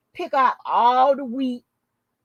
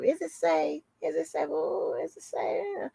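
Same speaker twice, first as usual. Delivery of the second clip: a hint of a fretful damsel, softer, rising on safe; mock damsel in distress, softer and pleading; 6 semitones higher, 7 dB quieter than usual. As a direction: lightly mimic a helpless damsel asking is it safe. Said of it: "Like some damsel in distress".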